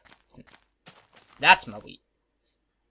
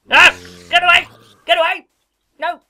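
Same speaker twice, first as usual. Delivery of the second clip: high, very loud scream of panic; terrified yell as enemies close in; panicked scream; 18 dB louder than usual. Real, not acted. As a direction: shriek at the mobs to get away. Attacked by mobs, panicked screaming.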